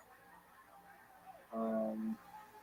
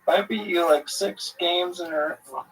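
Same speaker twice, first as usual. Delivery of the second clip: gloating; 9 semitones higher than usual. Real, not acted. Gloating after winning a round.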